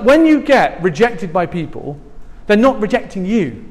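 normal talking voice

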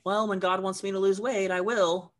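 high-pitched, self-excusing joke voice; mock excuse-making. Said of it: "every single night and saying".

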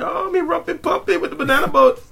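very high, hammed-up Caribbean accent; mock Caribbean accent. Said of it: "there was this one Jamaican dude".

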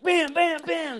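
high, punched-out bams; acted-out pounding. Diner retelling beating the sandwich, mock pounding yell. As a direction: imitate furious blows with the voice.

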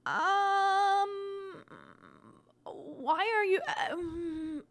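high, choked-up disbelief; shocked. Retold or imitated. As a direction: caught completely off guard. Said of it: "And I was like".

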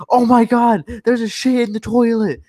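high, loud panicked outcry; mock screaming. Storyteller imitates store employees finding the mess, screaming in alarm. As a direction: act out horrified employees raising the alarm. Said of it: "And everyone's, like, screaming".

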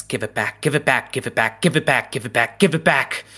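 overlapping cacophony. Acted. Game master voicing a cacophony in the player's ear, rapid overlapping repeats. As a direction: repeat it urgently, piling each on the last. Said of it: "like multiple audio clips playing at once".

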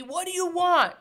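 mild reenactment of a movie yell; movie quote. Retold or imitated. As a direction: belt it out like the movie scene. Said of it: "that whole movie".

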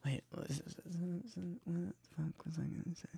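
whispered to self. Real, not acted. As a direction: whisper it under your breath. Forgetting mid-podcast.

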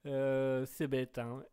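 usual speaking voice

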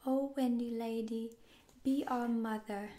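pleading cry. Acted. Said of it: "holding out their arms cried".

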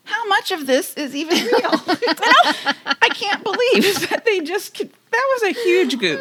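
talking through real laughter, up and loud; real laughing disbelief. Real, not acted. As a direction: laugh your way through the outrage. Laughing disbelief at magazine goof.

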